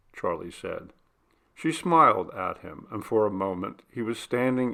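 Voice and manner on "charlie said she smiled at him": ordinary delivery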